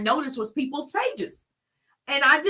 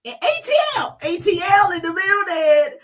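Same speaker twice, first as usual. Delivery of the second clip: high, loud hype announcement; hollering a shout-out; 7 semitones higher, 5 dB louder than usual. Real, not acted. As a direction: holler it like welcoming a crowd. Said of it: "hollering people names out".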